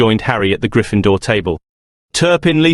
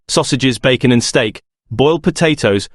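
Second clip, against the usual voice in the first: usual speaking voice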